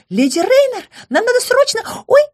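high, urgent call; urgent nymph. A narrator's reading. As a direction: blurt it out in a high rush.

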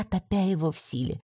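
plain reading voice

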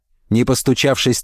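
ordinary delivery, nothing marked

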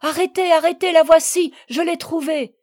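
high, loud cry to halt; father calling out urgently; urgent shout. A narrator's reading. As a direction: shout to stop people leaving.

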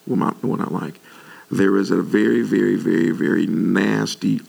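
ordinary talk

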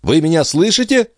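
high, urgent cry. A narrator's reading. Andrea to her unresponsive maid, crying out high. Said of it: "вскричала Андреа".

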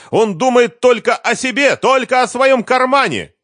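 very high, loud screamed accusations; spitting rage. A narrator's reading. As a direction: shriek against the father to his child. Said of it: "брызгала слюной Лиза".

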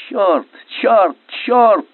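high cursing, rising in force; three desperate high-pitched yells; despairing shout. A narrator's reading. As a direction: curse out loud in despair. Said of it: "в отчаянии кричал Скрыдлов".

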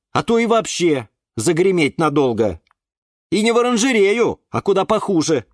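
high, exasperated scolding; Юра lecturing. A narrator's reading. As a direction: scold them with worried insistence. Юра отчитывает растения. (высокий голос, назидательно)

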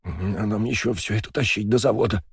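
words through chattering teeth. Acted. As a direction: shiver through the line. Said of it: "стуча зубами".

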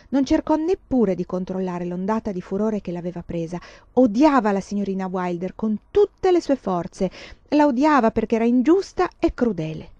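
ordinary delivery, nothing marked

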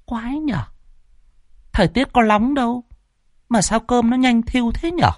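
put-on high, thin old woman grumbling to herself; a puzzled old granny muttering over sour rice. A narrator's reading. Old woman alone in the kitchen, puzzled muttering. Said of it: "Bà lẩm bẩm".

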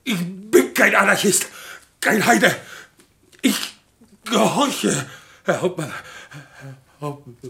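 high, loud, stammering plea breaking down; frantic soldier about to collapse; panicked apology. Acted. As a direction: beg forgiveness until your voice gives out. Begs pardon, then collapses.

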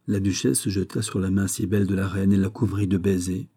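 ordinary delivery

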